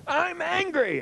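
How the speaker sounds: high, drawn-out imitation of an angry yell; high-pitched mock-angry holler. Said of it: "Yell it".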